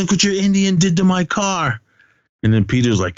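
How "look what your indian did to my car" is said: high-pitched indignant complaint; mock outrage. Retelling complaint about the rammed car, mock outraged. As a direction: imitate an aggrieved owner, pitched way up.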